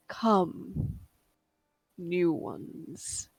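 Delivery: deep rumbling voice from the depths; low, slow, eerie call that fades to a whisper; grinding rumbling rock voice. Acted. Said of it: "deep rumbling voice".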